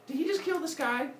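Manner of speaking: high, incredulous schoolkid voice; high-pitched student disbelief; puzzled pupil. Acted. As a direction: ask teacher like a baffled kid.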